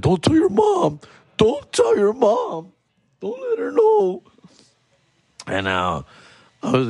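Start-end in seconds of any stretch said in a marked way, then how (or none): 0.0-4.2 put-on high, far kid voices begging; older kids pleading. Older kids begging the hurt boy, high pleading voice. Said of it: "the older kids be like".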